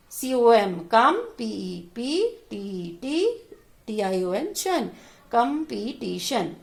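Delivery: "Competition" built slowly from कम, पी, टी, शन; slow, chunked sounding-out of "competition"; spelled out by syllable. Shown as a demonstration. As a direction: spell each piece, then join them as "competition". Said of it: "अलग-अलग करके पढ़ेंगे".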